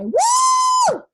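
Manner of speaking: very high sustained whoop; cheerleader woo. Real, not acted. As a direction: let out a piercing celebratory cheer. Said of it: "I used to be a cheerleader".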